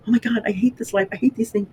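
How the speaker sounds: high-pitched mock whining; complaining. Daughter complaining to her mother about life, high exasperated whine. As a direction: imitate your own younger griping. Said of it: "when I used to complain".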